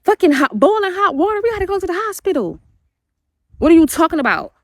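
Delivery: high, incredulous retold outburst; mother reacting to the son's burn, alarmed and high; retold outrage. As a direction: replay her disbelief, voice climbing high. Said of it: "And I said".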